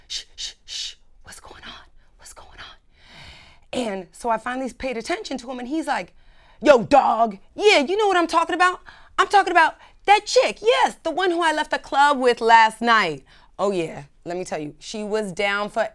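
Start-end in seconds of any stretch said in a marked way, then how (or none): 0.1-2.7 hushed unvoiced whisper of shushing bystanders; people whispering. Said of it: "I can hear all these people going". 6.6-13.2 imitating a guy yelling into his cell phone, raised and higher; loud phone dude. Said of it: "like, yelling".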